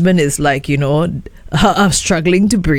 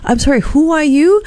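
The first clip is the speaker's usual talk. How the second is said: voiced higher, a confused wife on the phone; puzzled wife; 8 semitones higher than usual. Wife answering an unknown caller, higher and puzzled. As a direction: play her baffled and polite. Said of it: "And the woman's like".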